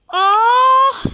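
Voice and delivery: loud high pained scream. Acted. Player acting out his character being hit, loud pained scream. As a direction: let out a sharp pained shout.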